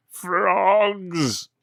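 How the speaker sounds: high, loud spooky trailer call; mock horror voice. Telling a friend the plot, a loud spooky movie-trailer call.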